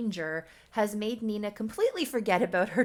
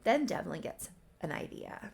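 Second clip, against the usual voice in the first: ordinary delivery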